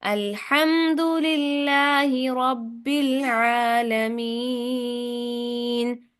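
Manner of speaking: long, melodic chanting of अल्हम्दुलिल्लाहि रब्बिल आलमीन; slow Quran recitation. Shown as a demonstration. Teacher models the verse for students to repeat. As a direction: recite slowly, holding each vowel. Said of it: "दोहराएं, सुनाएं".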